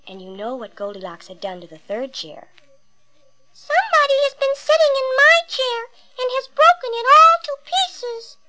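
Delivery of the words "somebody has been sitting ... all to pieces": put-on very high, squeaky baby bear voice; little small wee voice. A narrator's reading. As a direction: squeak it out like a tiny wailing cub. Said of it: "in his little small wee voice".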